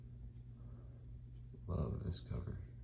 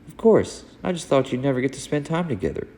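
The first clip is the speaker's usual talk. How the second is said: higher, brighter-pitched friend voice, kindly; friendly Lois; 8 semitones higher, 13 dB louder than usual. Acted. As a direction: welcome them like a happy host. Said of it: "try to change my voice a little".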